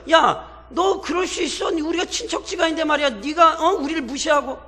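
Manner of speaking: high, loud accusing protest; angry quarrelling. Retold or imitated. Ephraimites confronting Gideon about being left out, angry protest. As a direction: demand it like offended kin picking a fight. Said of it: "이러고 따지는 거예요".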